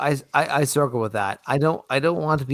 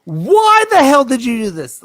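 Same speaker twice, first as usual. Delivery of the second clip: much higher, louder mock yell at staff; high angry boss shout; 14 semitones higher, 8 dB louder than usual. Shown as a demonstration. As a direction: bark at the employee. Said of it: "as opposed to".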